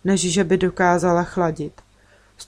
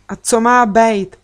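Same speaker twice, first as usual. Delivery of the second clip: loud, slow, defiant outburst; sudden outburst; 3 semitones higher, 6 dB louder, slower than usual. A narrator's reading. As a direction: burst out louder, drawing the words out. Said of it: "rozkřikl se najednou Nicky". (náhle se rozkřiknout)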